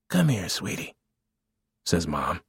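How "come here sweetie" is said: soft, higher motherly call; tender coaxing. Acted. Mother calling her hungry little boy, tender. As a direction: coax a small child gently.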